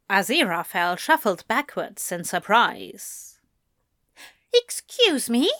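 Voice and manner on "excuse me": high-pitched, taken-aback question; flustered faltering. A narrator's reading. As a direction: ask it thrown off balance. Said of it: "he faltered, flustered".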